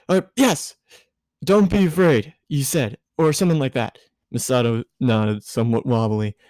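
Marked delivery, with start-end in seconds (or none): 0.1-4.0 put-on flustered boy voice. Shinji voiced by reader.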